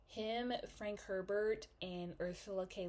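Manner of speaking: usual speaking voice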